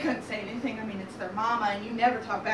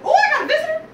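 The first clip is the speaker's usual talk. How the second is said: high, loud, giddy piglet cry; delighted little pig; 10 dB louder, much faster than usual. Acted. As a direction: squeal happily at the thought of company. Pig thrilled by knock.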